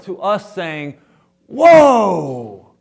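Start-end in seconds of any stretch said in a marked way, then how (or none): 1.5-2.7 loud, high startled cry; surprised whoa. Retold or imitated. Moses startled by the talking bush, loud whoa. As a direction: blurt it out in shock. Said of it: "it's an expression of surprise".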